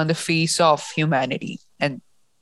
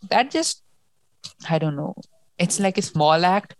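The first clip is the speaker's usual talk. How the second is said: ordinary delivery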